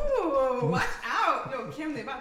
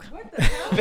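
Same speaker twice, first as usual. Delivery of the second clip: shocked; 4 semitones higher, 14 dB louder than usual. Real, not acted. Shocked reaction to humbug.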